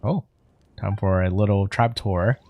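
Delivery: ordinary delivery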